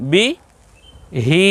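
brief "বি", pause, long "হি"; separated syllables. Shown as a demonstration. Teacher saying the word piece by piece, short then long. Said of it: "একবার যদি বলি".

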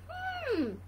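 high, falling purred hum; cat's purr. Acted. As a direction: hum like a pondering cat. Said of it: "purred the cat".

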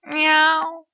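a cat answering the woman; cat's meow. A narrator's reading. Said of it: "my father said".

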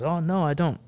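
put-on high-pitched French-accented denial; put-on innocent French reporter. French reporter insisting he has no phone, in a French accent. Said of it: "I can do a French accent".

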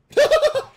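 short, high, loud laugh; sudden real cackle; burst of laughter. Real, not acted. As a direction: crack up briefly. Laughing at game.

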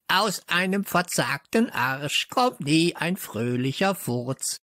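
high parrot screech. Acted. Parrot reciting a rude saying, high screech. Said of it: "kreischte Störtebeker aus vollem Hals".

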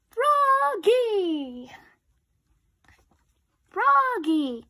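loud, high call of the name; teacher shouting Froggy. Acted. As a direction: call across the room to him. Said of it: "cried his teacher".

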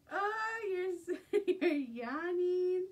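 high, slow, laughing coo. Real, not acted. Baby yawns.

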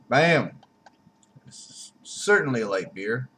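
ordinary talk

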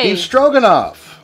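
loud, slow echo of the dish; excited. Real, not acted. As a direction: call the dish back joyfully. Echoing the excited call.

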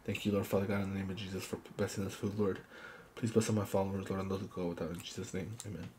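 hushed, low prayer over the meal; quiet prayer. Acted. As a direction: murmur a grace softly. Prays over the food.

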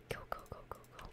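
whispering urgently to hurry. Real, not acted. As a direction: whisper in fear near the monster. Whispering near monster.

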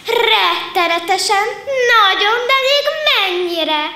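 high, laughing, gushing cub voice; delighted lion cub. A narrator's reading. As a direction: bubble over with joy like a little cub. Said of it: "örvendezett az oroszlánkölyök".

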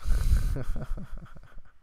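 loud burst of chuckling; real laugh. Real, not acted. Host amused at announcing the gossip topic, laughing. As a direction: laugh out loud, breathy and voiceless.

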